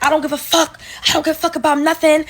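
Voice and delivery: mock yell, a bit fast; half-shouting the retold outburst. She yells back at the supervisor in the office, mock yell. I guess with us yelling.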